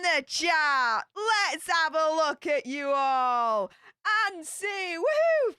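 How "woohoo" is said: cheery whoop. Acted. Cheering on reading a chat message.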